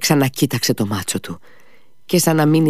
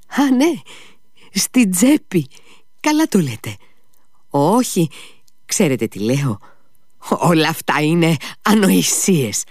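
higher, louder agitated outburst; agitated exclaiming; 9 semitones higher, 3 dB louder than usual. A narrator's reading. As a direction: snap out of a daze with a cry. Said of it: "φώναξε". (ξαφνική ταραγμένη κραυγή)

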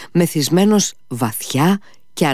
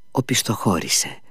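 ordinary delivery, nothing marked

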